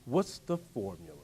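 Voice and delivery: "What's the formula?" dropped low and soft, falling; quiet musing question. Shown as a demonstration. Preacher to crowd, musing softly "What's the formula?". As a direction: murmur "What's the formula?" to yourself. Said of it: "I go on these little side tangents".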